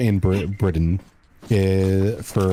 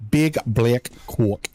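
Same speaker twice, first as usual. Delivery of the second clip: slow, drawn-out Boston accent; 3 semitones higher, slower than usual. Shown as a demonstration. Joking to chat, redoing the phrase in a slow Boston accent. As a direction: lean into a jokey Boston accent. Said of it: "And I'm gonna say it with a Boston accent".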